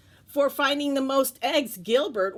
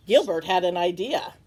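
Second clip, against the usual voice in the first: usual speaking voice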